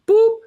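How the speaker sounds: high, loud beep sound; imitated scanner beep. Shown as a demonstration. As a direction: mimic a checkout scanner beep. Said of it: "the default setting is that everything you buy is like".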